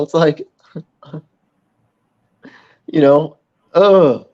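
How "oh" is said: loud, raised groan of exasperation; frustrated groan. Real, not acted. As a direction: let out how tiresome the slowness is. Frustration at slow eyeball device.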